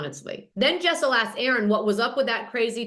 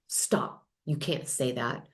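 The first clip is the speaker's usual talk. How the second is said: urgent whispered stop; hushed scolding; 8 semitones lower, 6 dB quieter, slower than usual. Retold or imitated. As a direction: hiss it under breath. Wife scolding husband on camera.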